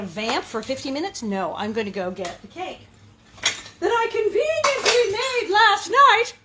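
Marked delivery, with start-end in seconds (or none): 3.8-6.3 high, loud, slow arch TV chef; mock TV chef. Admitting the cake was premade, arch mock TV chef voice. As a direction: ham up the cooking-show magic trick. Cooking-show fake convenience joke.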